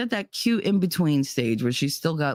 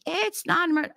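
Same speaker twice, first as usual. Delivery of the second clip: very high, mimicking brush-off; mock the nurse in a high voice; mocking nurse imitation; 14 semitones higher than usual. Nurse refusing a frantic mother, mockingly mimicked.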